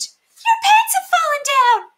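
very high, loud, slow shriek; screaming ladies. Acted. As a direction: scream it out in shock. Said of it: "the ladies screamed".

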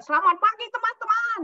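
loud classroom hello over chatter; strong loud greeting. Retold or imitated. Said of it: "saya suaranya kuat".

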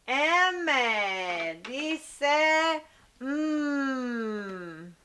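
M dice m, humming the m very slowly; letter sound demo. Shown as a demonstration. (pronunciación lenta del sonido de la M)

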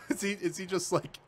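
ordinary delivery

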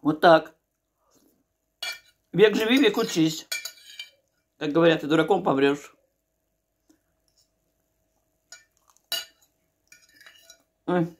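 talking while chewing; eating mid-sentence; mouth full. Real, not acted. Seen 2: puts fork food in mouth, chews and talks throughout.

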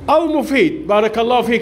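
ordinary talk, nothing marked

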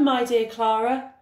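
usual speaking voice

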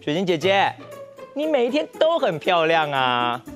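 ordinary delivery, nothing marked

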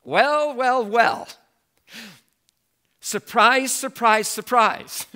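spring the reveal with playful surprise; mock surprise.

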